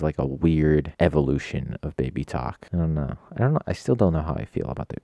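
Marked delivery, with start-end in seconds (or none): none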